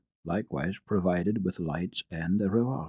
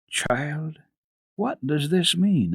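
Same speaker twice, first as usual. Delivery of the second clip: higher, slow, puzzled question; lightly voiced master of the house; 7 semitones higher, 4 dB louder, much slower than usual. A narrator's reading. Sesemann questioning the child in the hall, mildly stern. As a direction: ask as a startled gentleman.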